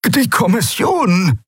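anxious croaked warning; fearful croak. A narrator's reading. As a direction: rasp it out in fear. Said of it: "krächzte Rubandra ängstlich".

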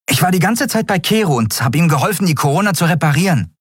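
put-on very high, fast boyish voice; young Miko, eager. A narrator's reading. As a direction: rush the caring question out. Boy Miko boasts proudly. (hohe Jungenstimme, schnell und besorgt)

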